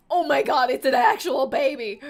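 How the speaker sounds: loud shocked exclamation. Real, not acted. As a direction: shout it out in shock. Shocked by baby appearing.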